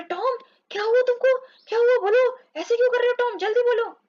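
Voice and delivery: high, urgent frightened questions; panicked. Acted. Sid frightened seeing Tom cry, high and urgent. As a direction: beg him to answer, alarmed. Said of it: "वो घबरा गया था".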